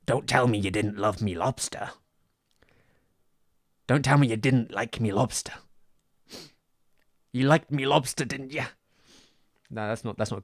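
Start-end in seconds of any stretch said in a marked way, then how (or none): 0.0-1.9 loud gruff sea captain; Willem Dafoe impression. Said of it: "I fucking love that line". 3.9-5.5 shouted gruff sailor line; louder, rough sailor take. Retold or imitated. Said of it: "I fucking love that line". 7.3-8.7 loud gruff sea captain; Willem Dafoe impression. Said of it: "I fucking love that line".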